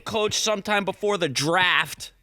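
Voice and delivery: a hint of a star quarterback turned broadcaster, laughing; playful light impersonation with a laugh; Tom Brady voice. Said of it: "is that your Tom Brady impersonation?".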